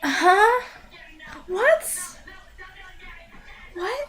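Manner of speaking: high, rising, stunned questions; stunned little questions with long gaps; baffled disbelief. Retold or imitated. Reviewer reacting to the fall into her breasts, baffled.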